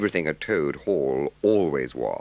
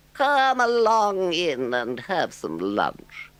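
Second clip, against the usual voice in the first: diplomatically; 5 dB louder, much slower than usual. A narrator's reading. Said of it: "he said diplomatically".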